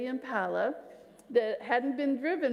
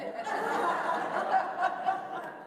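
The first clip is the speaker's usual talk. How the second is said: laugh; 7 semitones higher than usual. Real, not acted. Laughing at own story.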